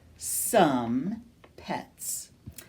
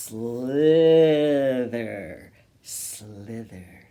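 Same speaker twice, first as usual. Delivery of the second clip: drawn-out hissy slither fading away; playful snake voice; snaky slither; 7 semitones lower, 4 dB louder, slower than usual. Pretend. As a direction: make the word slide like a snake. Acting out a snake.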